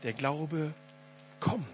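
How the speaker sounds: leise, mit Pause vor „kommt“; Betonung auf „kommt“. Shown as a demonstration. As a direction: lass „kommt“ nach einer Pause einzeln fallen. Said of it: "das ist ganz wichtig". („kommt“ leise und bedeutungsvoll abgesetzt)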